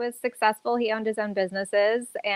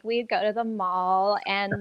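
ordinary talk, nothing marked

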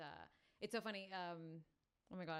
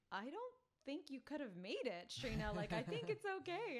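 gentle teacher; 8 semitones higher, 7 dB louder than usual. Retold or imitated. Teacher Ela Devi reassuring sick student, high gentle voice. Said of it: "And she's like".

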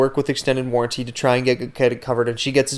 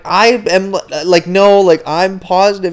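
insistent; 7 semitones higher, 5 dB louder than usual. Retold or imitated. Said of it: "And I'm like".

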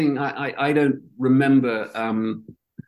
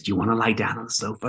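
softer, fast therapist voice; imitating the shrink; 4 semitones lower, 6 dB quieter, faster than usual. Quoting his shrink to old friends, softer and quick mimicry. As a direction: ape the shrink's soft, hurried invitation. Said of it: "he spoke like this".

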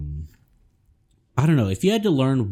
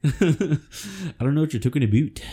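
higher mock Minnesota accent; 8 semitones higher than usual. Joking about the professor's speech, mock Minnesota accent. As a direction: play along as a Minnesotan, pitched up. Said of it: "accent and everything".

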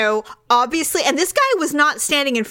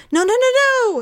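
high, urgent repeated no; frantic no; 13 semitones higher, 3 dB louder, much faster than usual. Retold or imitated. Waiter rushing over to stop her, urgent high call. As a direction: stop her before she drinks it.

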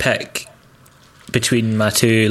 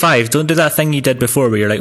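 normal talking voice

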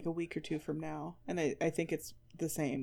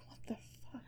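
breathy whispered otter voice, much softer; whispering otter; 15 dB quieter, much faster than usual. Pretend. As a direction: voice the otter as a baffled hushed whisper. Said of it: "That river otter's like".

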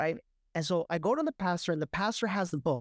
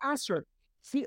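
mock desperate plea, pitched up; retold plea to the pastor, voiced higher; pleading to pastor; 8 semitones higher than usual. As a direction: beg the pastor in a higher put-on voice. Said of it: "So I'm like".

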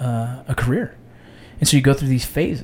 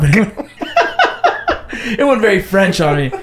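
laughing; 9 semitones higher, 4 dB louder than usual. Real, not acted. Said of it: "You said it all French like that?".

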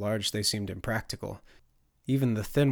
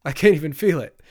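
high, loud, giddy exclamation; raised, giddy delight; laughed; 5 semitones higher, 11 dB louder than usual. A narrator's reading. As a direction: blurt it with amused amazement. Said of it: "Turner laughed".

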